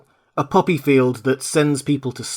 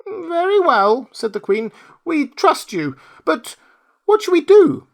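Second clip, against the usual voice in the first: mouse queen; 5 dB louder than usual. Acted. Queen tells her mice who saved her, squeaky. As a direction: squeak with royal dignity.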